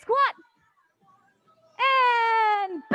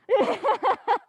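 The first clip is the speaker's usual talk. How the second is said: laughing. Real, not acted. Laughs at her own joke.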